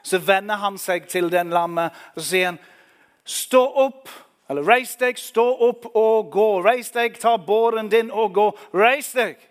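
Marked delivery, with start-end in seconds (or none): 3.2-9.4 loud, high command to rise; Jesus commanding the lame man to stand; commanding shout. Acted. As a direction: call him up off the mat with full force.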